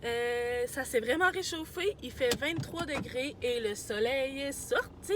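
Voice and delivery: usual speaking voice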